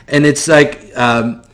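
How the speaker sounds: usual speaking voice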